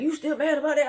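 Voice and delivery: high, loud incredulous question; mocking imitation. Imitating him asking if she is still mad, mocking.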